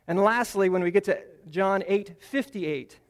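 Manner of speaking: usual speaking voice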